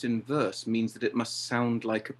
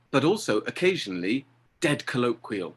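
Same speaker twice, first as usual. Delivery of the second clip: ordinary talk, nothing marked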